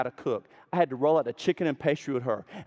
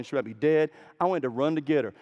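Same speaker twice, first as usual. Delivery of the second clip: ordinary delivery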